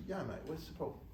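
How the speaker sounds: a hint of a put-on British editor; lightly imitated British accent; bad British accent. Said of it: "this terrible British accent".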